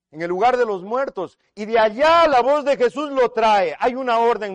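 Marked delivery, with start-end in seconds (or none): 1.5-4.5 loud, high preaching voice; fired-up pulpit storytelling; dramatic proclamation. Real, not acted. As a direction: proclaim it with rising force to the congregation.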